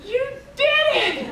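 very high, loud cry of joy, slowly; thrilled shout. Retold or imitated. Wife shrieking with joy at husband's fix, very high. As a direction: squeal with delight. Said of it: "I said".

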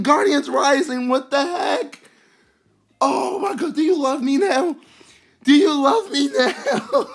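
high, giddy, laughing excitement; squealing with joy at the luck; overjoyed. Real, not acted. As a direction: gush with real happiness, pitched high. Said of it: "I'm so happy I pulled these".

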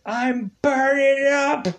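high, slow cry of pain; burning agony. Retold or imitated. David ripping off his shirt mid-transformation, high pained cry. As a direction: cry it out as if on fire. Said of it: "he's like".

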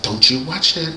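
imitating mom, keep it hushed; mother's warning. Mother warning kid about Thriller, softly imitated warning. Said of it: "my mother's like".

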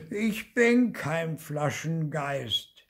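high, slow ghostly whimper; plaintive little ghost, words dragged; wailing whine. A narrator's reading. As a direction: whine it out miserably. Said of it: "jammerte das Gespenst".

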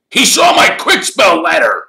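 high, loud, furious accusation; angry snarl. A narrator's reading. Caretaker saying Harry read his letter, snarling. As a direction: snarl the accusation at full volume. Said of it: "snarled Filch".

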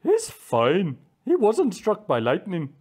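lightly called-out bird voice; calling out. A narrator's reading. Cousin bird reporting on Wham Wham, calling out. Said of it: "He called out".

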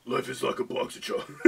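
low, quiet character growl; deep, restrained character voice; Nathan Explosion. Acted. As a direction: drop the voice low for a cartoon impression. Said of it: "Nathan Explosion".

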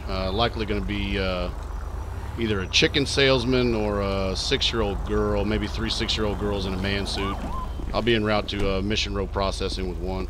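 even cop radio voice. Acted. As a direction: report in like a tired deputy. Radio call to dispatch.

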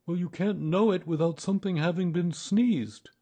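high, loud bear arguing back; insistent Pooh Bear. Acted. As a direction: argue the point in a high-pitched bear voice.

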